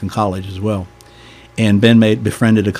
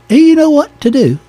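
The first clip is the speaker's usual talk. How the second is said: very high, loud mock English bishop; butchered English accent; 6 dB louder than usual. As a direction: question it in a bad English accent. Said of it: "I'm gonna butcher the accent".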